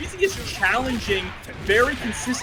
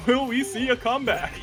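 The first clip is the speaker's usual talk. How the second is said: fighting game announcer; 6 semitones higher than usual. Retold or imitated. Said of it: "the in-game announcer putting me out of a job".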